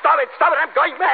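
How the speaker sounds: high frantic cry of panic; panicked shriek. Acted. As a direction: lose control, shrieking. Doctor breaks down in panic.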